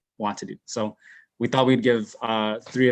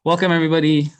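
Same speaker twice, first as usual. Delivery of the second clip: loud welcome; 6 semitones higher, 7 dB louder, faster than usual. Real, not acted. Greeting the audience going live.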